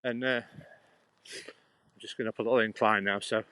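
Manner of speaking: ordinary talk, nothing marked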